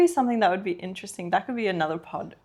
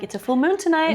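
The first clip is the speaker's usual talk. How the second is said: mom's excited-sounding text, higher pitched; imitating mom; 8 semitones higher, 4 dB louder than usual. Daughter quoting mom's witchy texts, in imitation of mom. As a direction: lift it up high, quoting mom. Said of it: "she'll be like".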